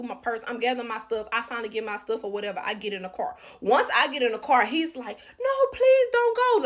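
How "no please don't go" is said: imitating crying plea. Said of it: "Like, crying his eyes out".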